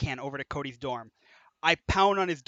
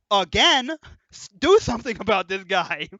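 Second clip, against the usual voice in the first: louder, higher exasperated repeat; exasperated advisor; 3 semitones higher, 8 dB louder than usual. Retold or imitated. Dorm advisor fed up with the repeat thief, loud exasperated. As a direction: throw up your hands and demand action.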